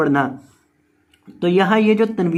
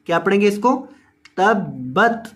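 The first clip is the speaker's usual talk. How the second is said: ordinary delivery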